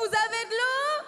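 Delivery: loud, high-pitched holler; big voice. Acted. Trying the other side for water, big shouted call. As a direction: call out to someone far away. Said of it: "t'as vraiment une grosse voix".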